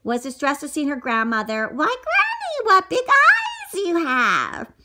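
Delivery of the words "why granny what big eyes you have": put-on high, slow wide-eyed girl; high amazed girl. Girl staring at granny's eyes, high and amazed. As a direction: marvel up high like a small girl.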